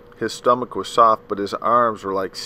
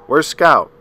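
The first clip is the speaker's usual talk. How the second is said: urgent call; 6 semitones higher, 11 dB louder than usual. A narrator's reading. Father calling urgently from room.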